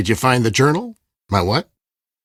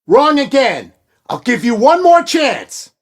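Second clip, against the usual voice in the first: higher, louder, slow final warning; angry threat; 10 semitones higher, 4 dB louder than usual. Acted. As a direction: spell out the last chance. Villain threatening during failed combination.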